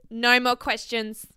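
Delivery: firm no. Real, not acted. Ending the topic, firm repeat. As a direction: say it again firmly.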